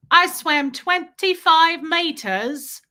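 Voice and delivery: put-on high, slow British little-boy voice; stretched-out, singsong British kid accent. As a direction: imitate a proud British child, drawn out. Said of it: "I love kids with accents".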